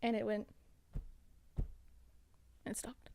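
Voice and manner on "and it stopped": whisper. Real, not acted. Hushed end of ghost story.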